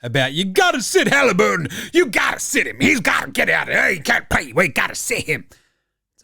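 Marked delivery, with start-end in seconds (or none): 0.3-5.4 hammed-up shouting over a co-host; mock pundit shouting. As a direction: yell it like a TV blowhard. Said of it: "just fucking screaming over the top".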